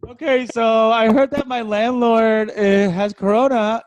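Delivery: loud, high, slow joke setup; struggling onstage delivery. Retold or imitated. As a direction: push the joke out while tripping. Reenacts telling joke while tripping.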